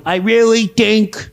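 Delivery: slow, deliberate politician impression; mock-earnest drawn-out delivery; Chuck Schumer. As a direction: draw the words out, peering over the glasses. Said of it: "with his glasses going".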